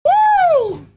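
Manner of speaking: high whooping cheer; excited whoop. Real, not acted. Surviving the sour candy.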